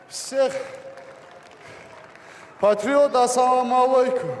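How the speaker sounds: high, loud closing greeting; loud shout. Real, not acted. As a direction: yell it to the arena. Боец выкрикивает приветствие залу. (громкий выкрик приветствия)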